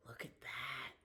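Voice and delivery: hushed look at that; whisper. Real, not acted. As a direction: whisper it in awe. Awed whisper at picture.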